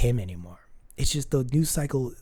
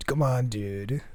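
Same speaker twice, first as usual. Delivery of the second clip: beach dude drawl; slower than usual. Real, not acted. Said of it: "my roots of being, of growing up on the beach".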